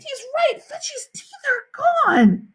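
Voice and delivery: put-on high, drawn-out girl's wail; horrified wail. A narrator's reading. Sheila confirming the teeth are gone, high horrified wail. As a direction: wail it slowly in horror. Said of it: "Sheila screamed".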